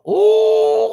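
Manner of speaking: loud, high drawn-out "ooh"; admiring whoop. Real, not acted. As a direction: squeal with admiration. Admiring Chevelle in a photo.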